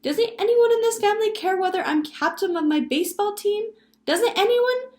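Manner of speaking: put-on high, loud, protesting child; boy interrupting. A narrator's reading. Benji complaining to family about baseball, loud interrupting child. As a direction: demand attention over your siblings. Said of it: "Benji interrupted him".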